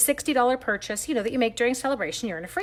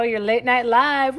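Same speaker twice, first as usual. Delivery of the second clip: normal talking voice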